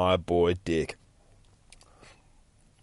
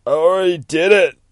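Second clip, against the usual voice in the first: anguished confession; 10 semitones higher, 11 dB louder than usual. Acted. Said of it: "he starts crying into his beer".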